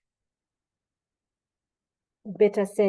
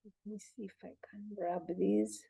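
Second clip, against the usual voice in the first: whispering; 15 dB quieter than usual. Real, not acted. Muttering aside while fetching things.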